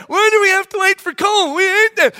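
put-on high, loud complaining kid voice; whining kid. Kid protesting having to wait for Cole, high whine.

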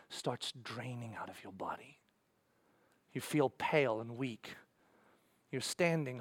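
soft, low, stunned telling; hushed grave storytelling. Acted. Preacher describing a follower reaching the cross, soft and stunned. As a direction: let it drain down toward a whisper, with long pauses.

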